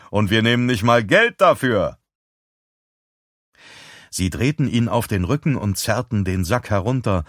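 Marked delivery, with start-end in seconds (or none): 0.1-2.0 mocking showman's call; raised, jeering voice. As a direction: crow it out, pitch arching up then falling. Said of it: "rief er".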